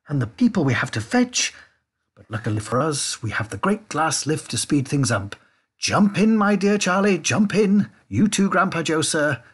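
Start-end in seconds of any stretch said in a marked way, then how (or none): 5.8-9.4 high, loud, hurried invitation; pitched up and bustling; eager urging. A narrator's reading. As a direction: call them aboard excitedly. Wonka hurries them into lift.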